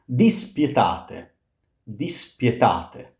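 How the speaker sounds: "Dispietate" with pye glide, slow; glide demo. Shown as a demonstration. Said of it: "much like piagare".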